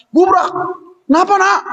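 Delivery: put-on high, alarmed mother greeting her child at the door; startled mother. Mother to her married daughter, high and alarmed. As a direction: act the shocked mum opening the door.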